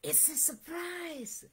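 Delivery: high, soft hush; whispered. A narrator's reading. Fox letting Frog in on the surprise, softly whispered. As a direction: share a secret softly. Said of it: "whispered Fox".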